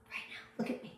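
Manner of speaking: breathy whisper, no voice at all; whispered plea. Real, not acted. Coach asking each viewer to look at her, whispered. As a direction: lean in and whisper it.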